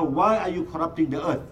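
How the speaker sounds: ordinary talk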